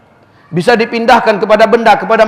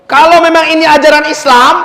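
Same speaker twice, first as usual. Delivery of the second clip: high, forceful retold challenge; 7 semitones higher, 4 dB louder than usual. Preacher confronting his friend in the retelling, forceful challenge. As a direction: launch the argument high and emphatic.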